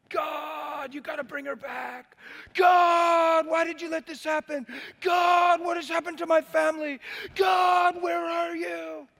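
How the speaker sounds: high, loud, anguished shouting at God; a man screaming at God for five days; desperate screaming prayer. Retold or imitated. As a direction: scream it up to heaven in despair. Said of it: "screaming at the top of my lungs".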